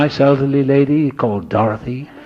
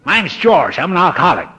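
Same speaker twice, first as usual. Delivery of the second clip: higher, louder booming introduction; hearty George; 8 semitones higher, 5 dB louder than usual. Retold or imitated. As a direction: boom it out like a big friendly man. George greets him at the door.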